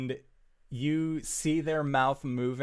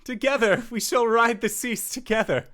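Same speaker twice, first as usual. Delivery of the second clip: loud, high theatrical summons; yelling; 10 semitones higher, 10 dB louder, faster than usual. Acted. Ship's figure beckoning the small one aboard, yelling. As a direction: declaim grandly across the waves. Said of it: "continuing to yell".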